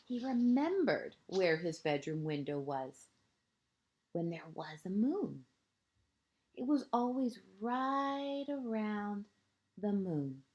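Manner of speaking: lilting picture-book narration, phrase ends hushed; reading to children. A narrator's reading. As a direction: read it like a bedtime story to a small child.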